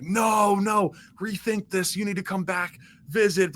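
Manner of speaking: mock begging, high-pitched with a drawn-out no; imitated pleading. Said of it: "like, pleading on the phone".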